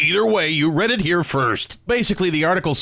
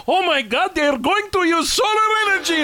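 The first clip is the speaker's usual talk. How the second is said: much higher, hammed-up panic as the oil prince; mock panicked prince; 9 semitones higher, slower than usual. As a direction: play the prince panicking about solar and wind.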